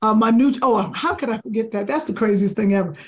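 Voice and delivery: ordinary talk, nothing marked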